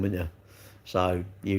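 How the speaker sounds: ordinary delivery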